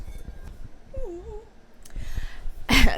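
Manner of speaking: high, long squealing laugh; drawn-out squeal. Real, not acted. Book buyer about to show a haul, high drawn-out squeal.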